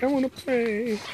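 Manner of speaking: high, soft whiny puppy voice; whimpering dog voice. Retold or imitated. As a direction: voice the dog pleading to play. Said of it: "Crimson's whimpering, like".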